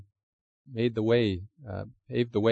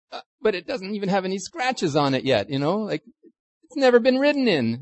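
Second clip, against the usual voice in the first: whiny reluctant owner; 8 semitones higher than usual. Retold or imitated. Owner voiced, reluctant about car.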